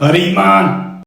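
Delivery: slow Scottish greeting; Scottish accent. Shown as a demonstration. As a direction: greet them like a Scot. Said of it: "Scottish people will greet you with".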